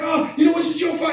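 half-shouted blame in a replayed argument; retold heated blame. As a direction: snap back in a retold quarrel.

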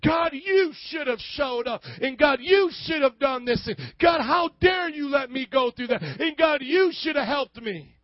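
mock angry complaint. Angry believer shouting blame at God, hammed-up fury. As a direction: rail at God like a wronged child.

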